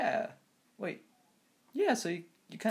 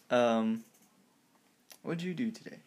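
ordinary talk

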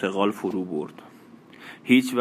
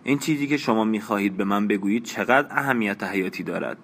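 usual speaking voice

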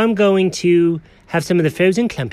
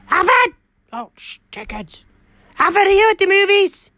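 calling out; 11 semitones higher than usual. Acted. Cat shouts into phone.

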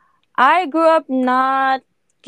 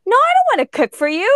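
high, loud and fast refusal; defiant retort; 10 semitones higher, 4 dB louder, faster than usual. Retold or imitated. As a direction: snap it back, quick and loud. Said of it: "I'm like".

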